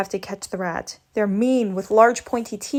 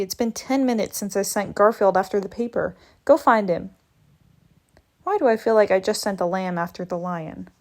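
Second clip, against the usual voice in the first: usual speaking voice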